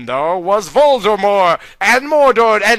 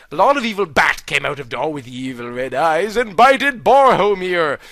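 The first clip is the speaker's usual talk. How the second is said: ordinary delivery, nothing marked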